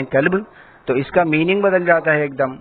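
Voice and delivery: ordinary talk, nothing marked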